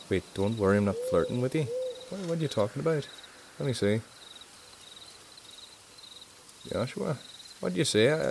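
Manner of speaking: lightly put-on Derry Northern Irish accent; a self-admitted bad Northern Irish accent attempt; a half-hearted Northern Irish accent from Derry. Shown as a demonstration. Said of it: "you'll tend to sound a bit like this".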